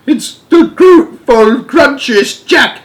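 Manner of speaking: high-pitched giant in a nightmare panic; terrified bellowing. Acted. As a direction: wail each line as a fresh attack. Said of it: "bellowed the flesh lump eater".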